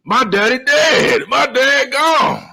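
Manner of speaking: slow, high, hammed-up grief; milk the line like a wounded teen; mock wounded drama. Josh retold, mock-grieving to his brother about his dad.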